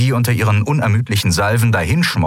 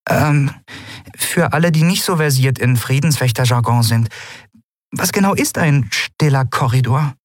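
higher, hesitant voice with pauses; awkward, high-voiced question; embarrassed questioner; 6 semitones higher than usual. A narrator's reading. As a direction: ask sheepishly, pitched up, starting on an um. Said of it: "verlegen die Flügel gesenkt".

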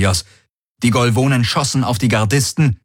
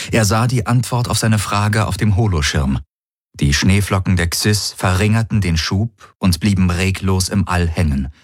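plain reading voice

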